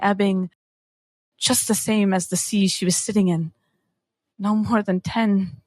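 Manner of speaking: ordinary talk, nothing marked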